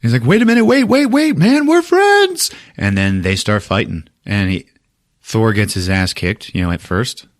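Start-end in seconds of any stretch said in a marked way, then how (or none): 0.2-2.5 imitating a high, loud frantic plea; panicked pleading. Thor pleads as Hulk attacks.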